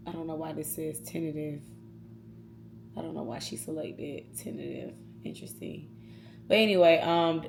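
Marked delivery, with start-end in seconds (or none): 0.1-4.9 much softer, puzzled aside; reading the screen under her breath; quiet puzzled mutter at her work screen; muttering to herself. Real, not acted. Muttering aside while checking work. 5.2-5.9 breathy whispered aside; soft whisper reacting to the screen. Real, not acted.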